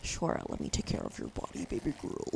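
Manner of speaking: low, whispered mock seductive line; deep mock flirty voice. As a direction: drop the voice down low, teasing.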